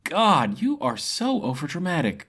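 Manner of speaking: sharp-tongued woman losing patience; exasperated cut-in. Acted. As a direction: roll your eyes out loud. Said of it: "Karana cut in".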